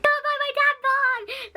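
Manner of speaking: high, loud, drawn-out retold wail; mock crying wail. On FaceTime to Scott in a panic, imitating sobbing wail. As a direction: wail it like she's falling apart, slowly.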